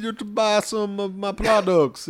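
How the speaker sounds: put-on a light Jamaican accent selling signed photos; mock Jamaican salesman. Actor in a Jamaican accent pushing his merch on fans. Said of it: "if he was the character".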